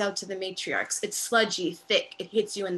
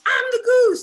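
excited shout; 14 semitones higher, 11 dB louder than usual. Acted. Inmate shouts playfully.

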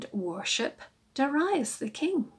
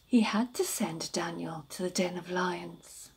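ordinary delivery, nothing marked

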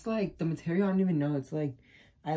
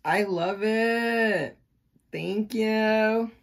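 gushing delight; 5 semitones higher, 5 dB louder, much slower than usual. Real, not acted. Loving the gift.

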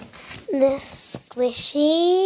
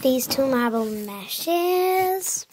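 ordinary talk

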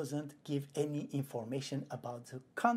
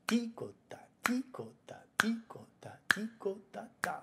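ticota whispered with T on each beat; 7 semitones higher than usual. Shown as a demonstration. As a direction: land every T on the beat. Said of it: "with the T being the beat".

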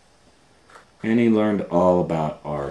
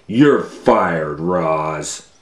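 louder stern boss voice; angry boss firing; 7 dB louder, much slower than usual. Acted. Boss firing the lunch lady Roz, loud and stern. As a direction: bark the firing at her. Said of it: "he said".

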